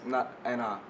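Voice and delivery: ordinary talk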